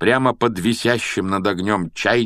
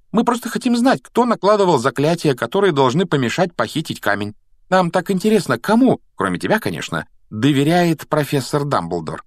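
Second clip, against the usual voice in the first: put-on high, coaxing girl's voice; butter him up in a high voice; flattering girl; 7 semitones higher, 3 dB louder than usual. A narrator's reading. Hermione flatters Hagrid about Dumbledore, high wheedling. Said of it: "В голосе Гермионы была неприкрытая лесть".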